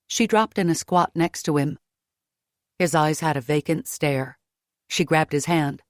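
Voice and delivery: ordinary delivery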